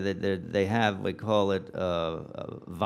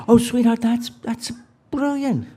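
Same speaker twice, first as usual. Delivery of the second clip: hammed-up adoring wife, pitched way up; gushing mock admiration; 12 semitones higher, 7 dB louder than usual. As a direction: flatter him as if awestruck by his genius. Acted wife gushing over husband's idea.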